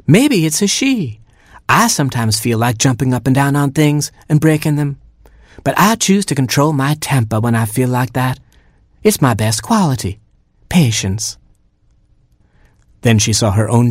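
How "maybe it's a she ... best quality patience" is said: Mama Hen. A narrator's reading. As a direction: begin high, then ease into self-satisfied hen. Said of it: "said Mama Hen".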